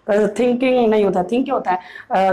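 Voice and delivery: ordinary talk, nothing marked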